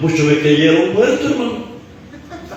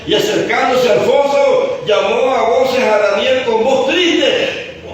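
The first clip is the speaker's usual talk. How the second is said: raised, higher scripture-telling voice; preaching declamation; 7 semitones higher than usual. Real, not acted. Preacher tells of the king at the lions' den, declaiming. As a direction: proclaim the story, stretching the phrase endings.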